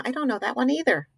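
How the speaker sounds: ordinary delivery, nothing marked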